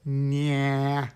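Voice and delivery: a long, held nasal "nya"; nasal puppet sound. Shown as a demonstration. Teacher demonstrating a puppet voice's core sound, very nasal. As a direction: drone it from back of nose and throat. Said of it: "from the back of your nose and throat".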